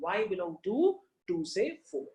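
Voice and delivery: teacher repeating the phrase; slow demo. Shown as a demonstration. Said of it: "like this".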